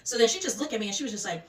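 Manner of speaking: usual speaking voice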